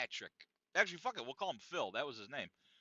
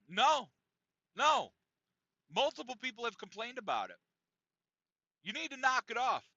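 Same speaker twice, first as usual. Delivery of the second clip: raised, firm objection; Bob peeved; 5 semitones higher than usual. Retold or imitated. Bob telling Sauna Santa off, imitated peeved voice. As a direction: act out an irritated telling-off. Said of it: "He's angry. He's, he's a bit peeved".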